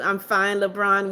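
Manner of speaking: usual speaking voice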